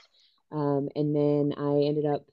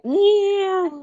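high, drawn-out noise of reluctance; reluctant groan; 8 dB louder than usual. Acted. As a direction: drag it out high and unwilling. Voicing reluctance about health matters.

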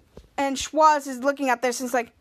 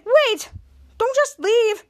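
high, raised retold plea; urgent calling; 9 semitones higher, 3 dB louder, much slower than usual. Henry calls to Charlotte as she leaves, urgent. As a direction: call after someone, pitched way up.

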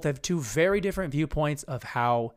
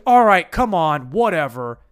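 loud mock brush-off; lightly voiced exasperated guy, louder; aggro guy; 8 dB louder than usual. Guy brushes off his date's complaint, loud mock exasperation. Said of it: "I made it more aggro".

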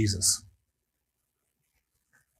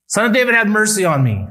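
pleading cry; 7 semitones higher, 4 dB louder than usual. Retold or imitated. Voicing a blind man's plea.

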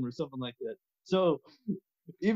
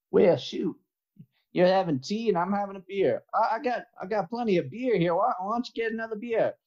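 Southern guy; 4 semitones higher than usual. Acted. Southern guy offering his tea-drinking friend another beer, Southern accent. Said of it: "you're the Southern guy".

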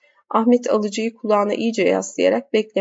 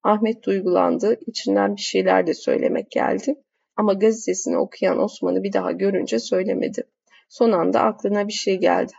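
ordinary delivery, nothing marked

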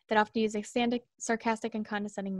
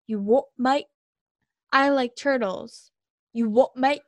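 mock British "you what mate", louder; British accent; 2 semitones higher, 7 dB louder than usual. Mock British bloke reacting to nonsense, incredulous British accent. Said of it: "used by English speakers from the United Kingdom".

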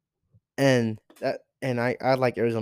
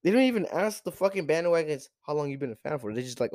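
normal talking voice

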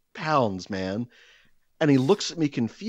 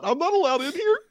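squeaked much higher in mock alarm; mock panic. Retelling misreading the pool sign, high squeaky mock alarm. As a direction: squeak like a scolded kid.

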